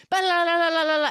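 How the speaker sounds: loud, high-pitched gibberish babble; imitating a torrent of Romanian; mock rapid Romanian. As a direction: rattle off nonsense syllables like foreign speech. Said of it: "speaking a mile a minute in Romanian".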